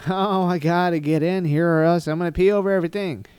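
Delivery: loud, high-pitched exaggerated urgency; mock desperation. Hypothetical self barging in to pee, mock-desperate. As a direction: ham up a frantic need to go.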